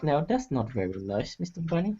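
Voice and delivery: soft murmur under his breath; murmur to himself. Acted. Man at the bar murmuring softly to himself. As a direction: mutter it quietly to yourself. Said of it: "He will just murmur to himself".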